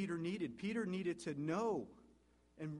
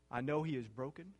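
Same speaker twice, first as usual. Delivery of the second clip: normal talking voice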